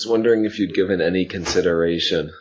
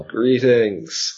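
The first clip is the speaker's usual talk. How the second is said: a moderate yell of greeting; hailing yell; 7 semitones higher, 3 dB louder than usual. Acted. Player hails the giant bird from below, calling out. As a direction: call out a higher-pitched greeting. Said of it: "wave my arms and yell".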